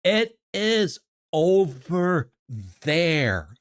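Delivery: over-enunciate to a foreigner; slow, loud and high. Retold or imitated. Loud, slow tourist-helper voice to a confused stranger. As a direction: stretch each word out loud and slow. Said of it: "going slower".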